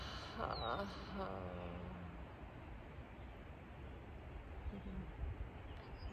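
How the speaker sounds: soft, unvoiced sighing breath out; long exhale. Shown as a demonstration. Guiding listeners' breathing, long soft airy sigh. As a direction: breathe all the way out slowly. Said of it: "and breathe out".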